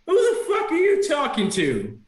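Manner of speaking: high, strained creature voice; bickering yell. Acted. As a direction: pick a fight as a squabbling redcap. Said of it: "begin to yell at each other".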